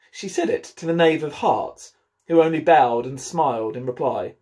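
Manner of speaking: lively read-aloud storytelling voice; story narration. A narrator's reading. Head teacher reading Alice to pupils, animated storytelling. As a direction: read the chapter like a bedtime story for the class.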